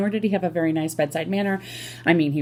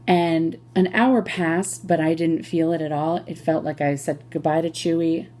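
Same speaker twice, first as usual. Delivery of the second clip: normal talking voice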